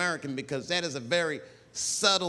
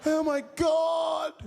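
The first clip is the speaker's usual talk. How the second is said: very high, loud panicked shrieks; high mock screaming; crowd screaming; 3 dB louder, slower than usual. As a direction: shriek like a horrified tourist. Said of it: "Everybody was screaming".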